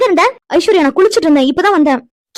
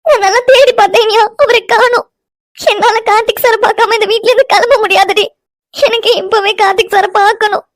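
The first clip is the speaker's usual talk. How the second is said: high-pitched tearful wail; crying; 4 semitones higher than usual. Acted. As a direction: sob the words out in distress. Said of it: "Vino, அழுகாதடி". (அழுதுகொண்டே புலம்பும் குரல்)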